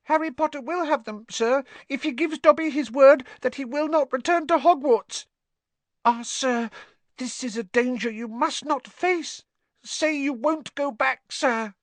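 very high, urgent elf voice; pleading house-elf. Acted. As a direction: beg in a squeaky little voice. Dobby pleads with Harry.

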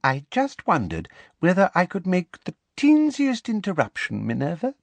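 put-on high, sugary, girlish politeness; high simpering lilt; simpering professor. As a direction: interrupt with cloying sweetness. Umbridge simpering to interrupt.

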